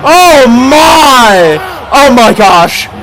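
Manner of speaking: stunned disbelief, rapid-fire exclamations; amazed. Real, not acted. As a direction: react like you can't believe it. Reacting to a huge backflip.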